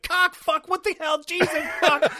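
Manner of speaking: high, loud startled cursing. Retold or imitated. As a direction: imitate both actors yelping in shock. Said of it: "they're both kinda like".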